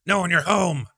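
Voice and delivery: high, loud put-on voice ranting the threats; mock Sam Hyde Irish rant. Mocking Sam Hyde threatening to visit Hassan, high hammed-up rant. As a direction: shout it like a deranged Irish character. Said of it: "And then it's like".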